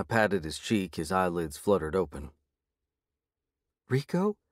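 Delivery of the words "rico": high, hopeful call of his name; hopeful woman. A narrator's reading. Woman calls to her waking boyfriend, hopeful. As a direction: reach for him with hope. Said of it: "the woman said hopefully".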